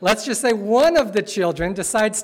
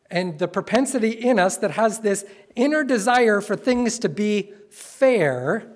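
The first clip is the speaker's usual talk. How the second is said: ordinary talk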